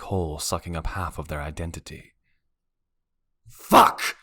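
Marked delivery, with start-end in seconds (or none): 3.5-4.2 sharp unvoiced scream of frustration. A narrator's reading. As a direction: spit the curse out hard into the empty room. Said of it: "I scream into the empty apartment".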